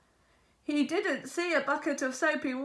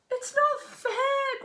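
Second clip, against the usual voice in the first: high, slow sulky complaint; grumbling; 11 semitones higher, slower than usual. A narrator's reading. Pete complaining after the captain's threat, slow sulky grumble. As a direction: grumble it like a sulking boy. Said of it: "grumbled Pete".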